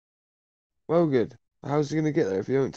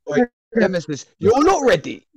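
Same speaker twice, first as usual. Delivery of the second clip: higher, louder warning shout; loud taunt; 9 semitones higher, 8 dB louder than usual. Real, not acted. Taunting rival Nemesis over voice chat, loud threat. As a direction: yell it like a threat he can't match.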